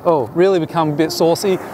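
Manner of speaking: ordinary talk, nothing marked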